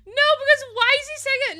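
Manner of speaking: very high, loud disbelief; exasperated outburst. Real, not acted. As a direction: squeal it in comic outrage. Baffled outburst after laughing.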